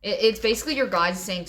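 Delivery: ordinary talk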